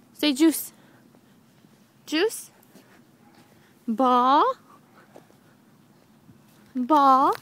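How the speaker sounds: put-on loud, bright word prompts for a baby; speaking to a toddler. Shown as a demonstration. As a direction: coax a baby to repeat words. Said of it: "Say baby".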